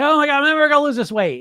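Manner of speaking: high, loud, fast mock panic; hammed-up wail of despair; a dieter sure she'll never lose it, mock panic; panicked dieter.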